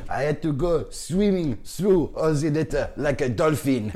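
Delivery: put-on slow, high, loud French accent; milk each word in a comic French accent; French friend's accent. French friend describes swimming through litter, slow French accent. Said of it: "he said the best thing I've ever heard".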